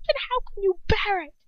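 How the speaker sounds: high-pitched, raging question; enraged yell. A narrator's reading. Ron yelling at Hermione, almost raging with anger. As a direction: yell it in angry disbelief. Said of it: "he yells, almost rage with anger".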